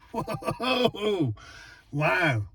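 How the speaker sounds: high, delighted whoa with a laugh; excited whoa. Real, not acted. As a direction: react with real amazement. Excited reveal of new headlights.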